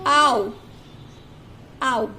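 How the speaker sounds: teacher sounding out आओ; ow sound demo. Shown as a demonstration. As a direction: repeat आओ clearly for the class. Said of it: "दोनों का sound होता है आओ".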